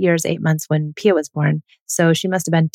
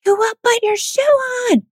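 piercing scream, far higher; screaming at her child. Retold or imitated. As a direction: shriek it right into her face, out of control. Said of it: "I screamed in her face".

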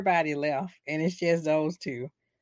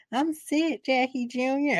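mock sickly whine; 7 semitones higher than usual. Meadow voiced sick, whimpering.

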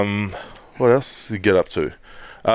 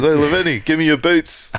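high, pushy mate demanding boots; mimicking a cheeky teammate grabbing boots; rude demand; 8 semitones higher, 3 dB louder than usual. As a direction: bark it like he's owed them. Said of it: "Not even asked nicely".

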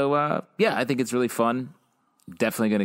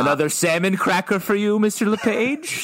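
lightly voiced posh butler, higher; a hint of a snooty butler; 9 semitones higher, 3 dB louder than usual. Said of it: "says the imaginary butler".

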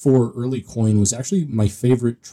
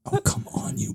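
creepy breathy whisper, pitched up; heavy breathing phone whisper; 9 dB quieter than usual. Pretend. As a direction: hiss it like a prank caller. Joking creepy threat.

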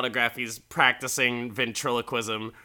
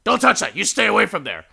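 higher, loud protective snap; sharp warning; 8 semitones higher, 9 dB louder, faster than usual. Retold or imitated. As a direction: bark him away from the case. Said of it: "Don Rickles just".

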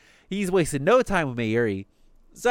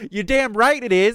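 high, louder indignant comeback; retold pissed-off retort; 8 semitones higher, 5 dB louder than usual. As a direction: play the fired-up character snapping back. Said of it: "he gets pissed".

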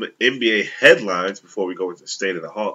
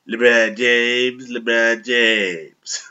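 slow, drawn-out nasal LeBron James; 2 semitones higher, 4 dB louder, much slower than usual. Shown as a demonstration. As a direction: stretch the name out through the nose. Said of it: "I can do it really good because I'm kinda nasally".

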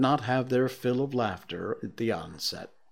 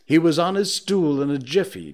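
usual speaking voice

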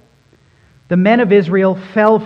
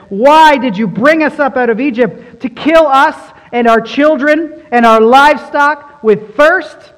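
complaining crowd, pitched up and louder; grumbling Israelites; 7 semitones higher, 7 dB louder than usual. Acted. Israelites grumble against Moses, a loud complaining whine. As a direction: whine it at Moses as a thirsty people. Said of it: "grumbled against Moses and said".

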